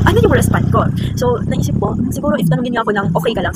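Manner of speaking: usual speaking voice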